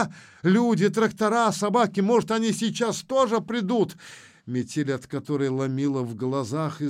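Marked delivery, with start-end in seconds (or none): 0.0-4.0 high, louder, eager babble; excited chattering. A narrator's reading. Женька hoping help is near, excited chattering. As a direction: chatter hopefully in a raised voice. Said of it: "затараторила Женька".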